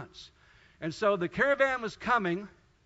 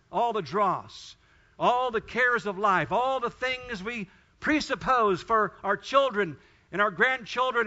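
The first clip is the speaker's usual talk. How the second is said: louder, higher preaching, slowed on the list; preaching at full force; 3 semitones higher, 6 dB louder than usual. Acted. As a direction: pound out each item for the church. Preaching, slow and loud.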